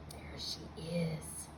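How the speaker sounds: breathy hushed reveal; whisper. Acted. Reading to kids, whispering the reveal.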